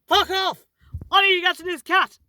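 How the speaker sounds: high, loud furious outburst; retold angry shout. Pedestrian rushing to the cat, angry shout at car. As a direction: re-enact yelling at a driver.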